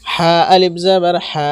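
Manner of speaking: closing হা held long; one-alif elongation. Shown as a demonstration. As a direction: stretch the final হা evenly. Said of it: "টান হবে এখানে".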